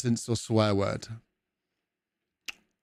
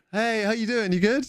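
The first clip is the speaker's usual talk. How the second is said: breezy passing greeting; 10 semitones higher than usual. Retold or imitated. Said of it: "you're like".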